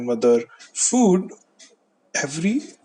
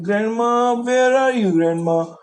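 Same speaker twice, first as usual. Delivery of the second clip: high, raised call for grandma; 7 semitones higher, 5 dB louder than usual. Acted. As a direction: call through the house, searching for someone. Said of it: "she was calling and looking for her grandma".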